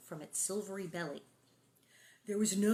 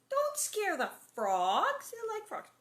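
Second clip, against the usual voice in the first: playful scolding; 12 semitones higher, slower than usual. Real, not acted.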